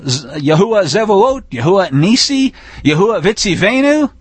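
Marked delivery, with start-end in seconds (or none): none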